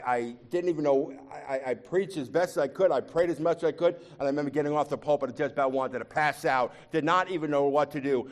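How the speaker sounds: ordinary delivery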